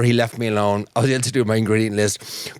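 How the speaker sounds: ordinary talk, nothing marked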